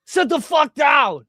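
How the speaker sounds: hammed-up driver shouting orders; mock bus driver yell. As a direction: yell at rowdy passengers to sit.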